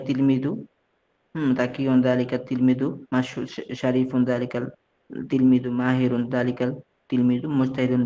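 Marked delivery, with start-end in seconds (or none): none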